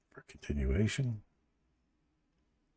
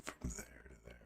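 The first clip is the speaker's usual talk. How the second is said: whisper; 6 semitones lower, 21 dB quieter than usual. Real, not acted. Whispered aside while trading.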